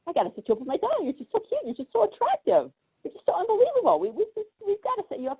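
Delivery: hammed-up smitten woman voice; gushing lady. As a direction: gush over him like a smitten old lady. Lady in love with him.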